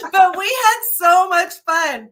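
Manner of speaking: high, loud, drawn out through laughter; real laughing delight. Real, not acted. Leader recalling her long visit with Janice, laughing. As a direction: gush it out while laughing.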